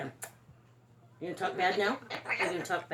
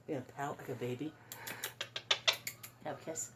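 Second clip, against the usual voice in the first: much softer, coaxing a sulking pet; soft teasing; 7 semitones lower, 9 dB quieter, faster than usual. Real, not acted. Owner coaxing her sulking parrot into a kiss, soft and teasing.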